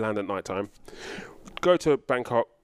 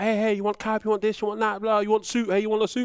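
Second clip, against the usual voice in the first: higher, lightly voiced pushy tout; imitating street sellers; 7 semitones higher than usual. Mimicking Bangkok touts offering cabs and suits, light tout voice. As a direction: hawk cabs and suits at a tourist.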